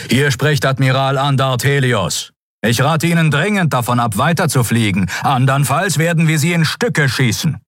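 commander's urgent radio warning; stern admiral. A narrator's reading. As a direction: warn the enemy off with authority. Admiral warns enemy over channel.

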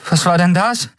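high-pitched, startled question. A narrator's reading. Miko reacts to the blinding red flash, startled. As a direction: blurt it out in alarm.

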